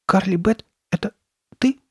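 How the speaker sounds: high, halting, faltering question; stammering murmur. A narrator's reading. Sabrina unsure it is her friend, stammering. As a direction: break it up with hesitant pauses. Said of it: "запинаясь, пробормотала она".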